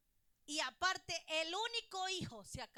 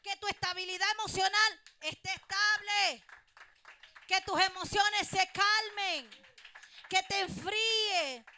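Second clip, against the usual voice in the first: fervent preaching; 4 semitones higher, 8 dB louder than usual. Acted. Preaching at full force.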